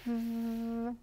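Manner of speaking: "우" as one long buzzing vvv; V letter sound. Shown as a demonstration. Teacher reviewing V, "우" softly buzzed as vvv. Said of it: "V 발음은 어떻게 해야 된다고요?".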